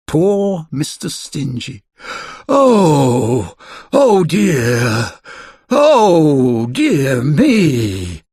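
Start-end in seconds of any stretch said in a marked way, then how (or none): 2.5-8.2 loud wailing lament. A narrator's reading. Mister Stingy wailing over his potatoes. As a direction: wail it out, drawn and miserable. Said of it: "he wailed".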